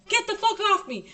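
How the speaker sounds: high-pitched mock screaming, pushing someone away; high, urgent retold cry. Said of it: "I'm fucking screaming".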